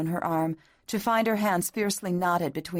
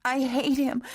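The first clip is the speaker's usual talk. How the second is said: high, louder cry of "I hate him"; sobbing outburst; 8 semitones higher, 3 dB louder than usual. A narrator's reading. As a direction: burst out with "hate". Catherine's loud bitter take.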